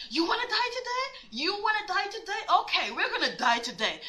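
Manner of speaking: high-pitched, incredulous challenge; angry confrontation. Retold or imitated. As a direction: throw it down like a dare. Retelling own furious confrontation in car.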